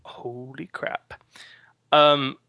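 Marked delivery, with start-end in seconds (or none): 0.0-1.1 slow awed whisper. Real, not acted. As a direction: breathe it out in a hush. Echoing the exclamation.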